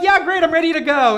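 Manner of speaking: high, loud over-keen reply; hammed-up breezy readiness; fake eagerness. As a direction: overdo the enthusiasm to impress. Feigned eagerness to impress stranger.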